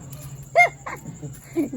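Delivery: soft, breathy high laugh; amused laugh. Real, not acted. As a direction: laugh lightly at the proposed deal. Laughs at the selling deal. (हल्की दबी हँसी)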